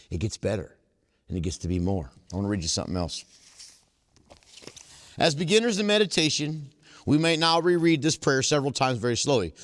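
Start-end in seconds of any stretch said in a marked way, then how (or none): 5.2-9.5 reading aloud. Acted. Reading aloud from the book to the meeting. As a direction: read the book passage to the group.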